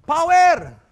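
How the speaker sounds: loud high shout of the word; full-voiced shout; shouted power. Shown as a demonstration. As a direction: yell it out to the street. Shouting outside as example.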